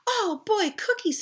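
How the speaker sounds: high, delighted squeal; light up over cookies; little brother thrilled by cookies; excited kid. Acted.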